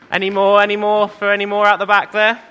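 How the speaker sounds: called out across the church; calling out. Real, not acted. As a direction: call out to the back. Calling to children at back.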